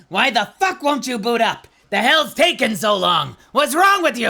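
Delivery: loud, high angry clown yell; crusty voice cursing. Acted. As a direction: scold the server like an enraged clown. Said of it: "me cursing at the server blade".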